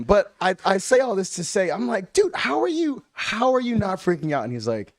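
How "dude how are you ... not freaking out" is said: high-pitched retold disbelief; incredulous. Friend questioning his unfazed pal, incredulous. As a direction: imitate yourself aghast at a calm friend. How are you not freaking out.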